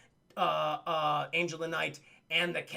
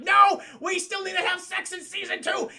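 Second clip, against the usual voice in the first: loud, high-pitched comic protest; mock desperate shout; 12 semitones higher, 7 dB louder than usual. Eliza stopping Demona from blasting Goliath, shouting. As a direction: yell it as a frantic objection.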